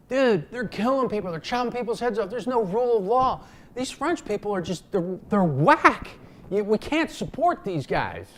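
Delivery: alarmed, they're beheading people; indignant outburst. Retold or imitated. Hamilton and Adams warning Jefferson off France, loud and indignant.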